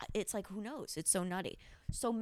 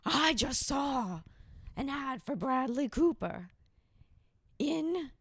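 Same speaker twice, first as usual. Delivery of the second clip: higher, slow, put-on whiny voice; mocking the guest; 6 semitones higher, 3 dB louder, slower than usual. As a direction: drag it out like a self-pitying guest. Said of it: "she was like".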